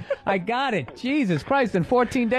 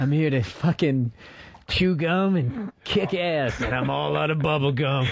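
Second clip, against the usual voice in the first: Schwarzenegger action hero. Retold or imitated. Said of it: "taking old Arnold Schwarzenegger lines".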